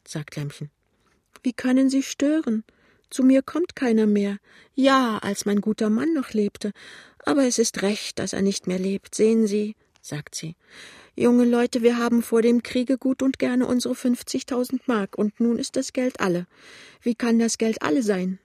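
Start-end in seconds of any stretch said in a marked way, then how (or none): 1.4-9.8 put-on high-pitched tearful old woman; tearful whine. A narrator's reading. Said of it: "sagt die klagende Stimme". 11.1-18.4 put-on high, fretful old woman; anxious. A narrator's reading. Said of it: "fragt sie ängstlich".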